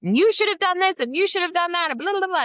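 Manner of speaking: put-on very high, loud screeching teen comeback; mock furious student. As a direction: ham up a shrieking angry girl. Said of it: "the students become an expert".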